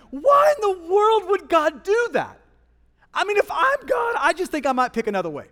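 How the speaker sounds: loud, high-pitched disbelief; incredulous questioning. Acted. Preacher asks why God would do that, loudly incredulous. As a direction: cry it out high, then tumble down quickly. Voices baffled question about God's choice.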